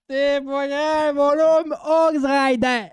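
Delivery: high, loud, slow hammed-up comfort; mock pep talk. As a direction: console him like a hype man. Mock consoling tribute.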